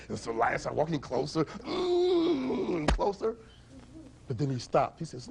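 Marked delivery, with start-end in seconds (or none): 1.5-2.9 long high savouring hum; hungry "mmm". Acted. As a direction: hum as if eyeing a tasty meal. Hungry lion creeping closer.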